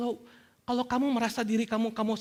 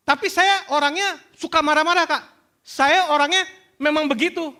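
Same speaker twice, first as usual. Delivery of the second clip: high, louder protesting voice; acted excuse-maker; 10 semitones higher, 6 dB louder than usual. Congregant defending their temper to the preacher, high insistent protest. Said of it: "pernah dengar kayak gitu?".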